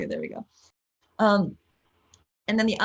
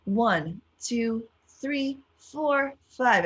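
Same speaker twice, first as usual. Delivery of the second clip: counting along; 5 semitones higher, much slower than usual. Shown as a demonstration. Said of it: "One more".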